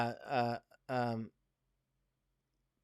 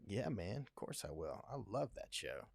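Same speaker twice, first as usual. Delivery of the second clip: softer, lower, almost to himself; a humble, hushed acceptance; quiet eager yes; 3 semitones lower, 12 dB quieter than usual. Retold or imitated. Recalling agreeing to come on the show: quiet eager yes. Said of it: "I was like".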